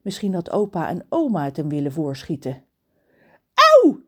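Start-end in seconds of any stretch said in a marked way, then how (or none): 3.5-4.0 very high, loud cry of pain; yelp of pain. Acted. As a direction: shriek like you were just pecked. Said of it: "John schreeuwt". (harde kreet van pijn)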